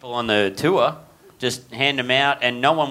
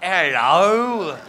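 a higher, louder posh British hello; posh British accent; 5 semitones higher, 4 dB louder than usual. Retold or imitated. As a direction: greet like Mary Poppins. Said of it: "Mary Poppins went on your fucking cruise".

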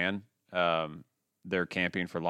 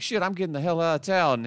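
retold character; 7 semitones higher than usual. Voicing rapper character in story.

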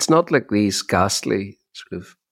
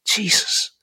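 unvoiced shocked whisper of Jesus; 10 semitones higher than usual. To his listener, recalling his inner shock, whispered. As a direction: breathe it out in disbelief. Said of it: "And I thought, like".

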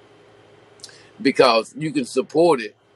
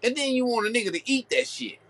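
higher-pitched retelling of a crude scolding, half-mocking; imitating a blunt scolding; 6 semitones higher than usual. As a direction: quote her scolding the crowd, voice pitched up. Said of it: "She said it just like that".

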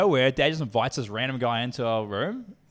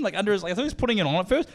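ordinary delivery

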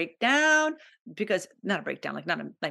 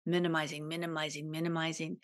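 each minimizing softer, sinking away; fading repetition; 3 semitones lower, 9 dB quieter, faster than usual. Real, not acted. She repeats minimizing to herself, fading softer each time. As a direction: let the word fade out on each repeat.

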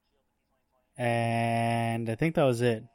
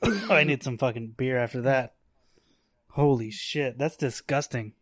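normal talking voice

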